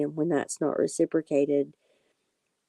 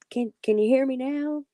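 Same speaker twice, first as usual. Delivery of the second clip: pitched way up, calling at the machine; yelling at the recorder; 8 semitones higher, 3 dB louder than usual. Retold or imitated. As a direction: demand the recorder hear you. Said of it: "I tried yelling at it".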